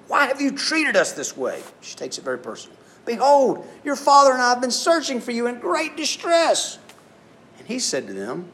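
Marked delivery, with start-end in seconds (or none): 0.1-1.6 much higher, slow, hurt motherly scolding; Mary scolding her lost son, much higher and pained; upset mother. Retold or imitated. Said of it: "And his mother said to him". 3.0-6.8 much higher, louder distressed mother; a frantic mom letting her son know how worried she was; Mary scolding her son after the search, much higher and louder; upset mother. Retold or imitated. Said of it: "And his mother said to him".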